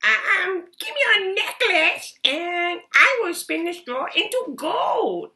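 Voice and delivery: high, loud goblin voice with a giggle; a tricky little goblin striking a bargain; sly goblin. Acted. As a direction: goblin offering a deal with a chuckle. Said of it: "he said".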